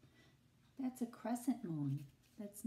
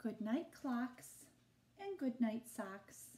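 ordinary delivery

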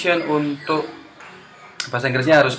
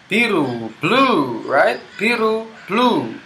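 higher, slowing to a drawn-out final call; teacher drill; 7 semitones higher, much slower than usual. Shown as a demonstration. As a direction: lead the class through the last colour. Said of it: "And the last color for today".

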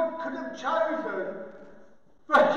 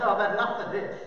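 low fed-up grumble; muted exasperation; 5 semitones lower, faster than usual. Acted. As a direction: say it lower, as if you have had enough. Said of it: "Peter's getting fed up".

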